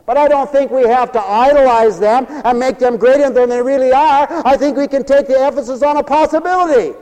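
loud, high, soaring preacher's cry; preaching at full force. Acted. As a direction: preach it out to the whole hall.

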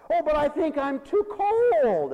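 loud, high, drawn-out complaint; mock lament. Caller makes excuses to the pastor, mock wailing. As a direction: wail the excuse in exaggerated self-pity.

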